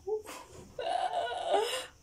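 high, tearful nervous laugh. Real, not acted. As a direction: laugh out your nerves, almost whimpering. Nervous before checking the camera.

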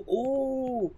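normal talking voice

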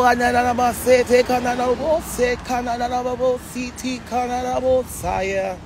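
rapid rhythmic glossolalia syllables; speaking in tongues. Real, not acted. Preacher praying in tongues on the live, rhythmic flow.

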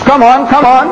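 lightly voiced impatient reverend urging a reply. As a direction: hurry him along, mocking.

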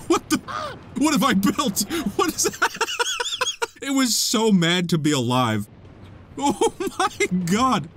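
high, laughing shock; cracking up at the mess; laughing disbelief. Real, not acted. Laughing at own contraption.

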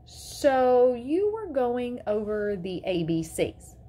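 pointed disbelief. A narrator's reading. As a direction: spell it out slowly, incredulous. Mother challenges the teacher.

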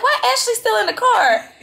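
high, incredulous wail with laughter in it; amused disbelief. Retold or imitated. Friend retelling finding her cousin still in the car, amused disbelief. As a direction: squeal the question, half laughing.

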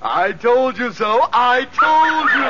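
smug teasing that builds to a high, slow laugh; gloating. Acted. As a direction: rub it in, savouring every word. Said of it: "stop gloating".

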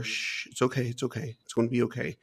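hushed, soft shushing reassurance; consoling. Retold or imitated. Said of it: "I'm trying to console her".

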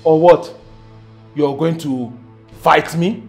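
low, quiet threat; dangerously quiet, deep taunt; menacing challenge. Acted.

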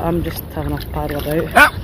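low, lazy voice for the dog; dog's inner voice. Pretend. Owner voicing the paddling dog Pixie, low and content. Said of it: "Pixie's like".

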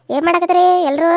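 loud hail to the household; loud visitor's greeting; calling out. Acted. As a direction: call out to everyone in the house. Visitor calls out on arriving.

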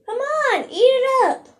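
high, sing-song coaxing to the puppy. Real, not acted. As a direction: coax the dog up high.

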